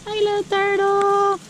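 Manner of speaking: sing-song to the little creature; baby talk. Acted. Vlogger greeting the turtle in high baby talk.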